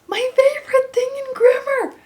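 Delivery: high, loud, gushing sarcasm; mock enthusiasm. Teacher mocking her favorite grammar topic, sarcastic gush. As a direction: oversell it like a fake fan.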